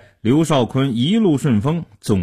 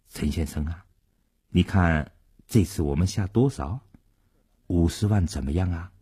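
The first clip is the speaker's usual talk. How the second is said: soft, low, confidential question; low-voiced consulting; 6 semitones lower, 5 dB quieter than usual. A narrator's reading. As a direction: quietly sound out a partner, pitched well down. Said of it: "低声征求贺楠楠的意见说".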